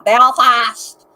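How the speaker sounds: loud, drawn-out Belfast twang; broad Belfast accent. Retold or imitated. Describing a friend's wife, loud hard Belfast accent. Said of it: "she was very".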